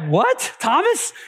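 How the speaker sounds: mock disbelief, voice jumping up on the name; startled double-take at a friend; incredulous. Nervous disciples react to Thomas's bold offer, incredulous.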